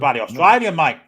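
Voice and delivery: a loud, hammed-up Australian accent; mock Australian accent. Ribbing mates about the Australian referee, loud mock Australian accent. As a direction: bark it out as a cartoon Aussie.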